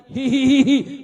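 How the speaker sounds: high, loud hee-hee-hee laugh; giggling. Acted. Slave girl laughs loudly at the third lash. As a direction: laugh it out, carefree. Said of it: "फिर हंसने लगी".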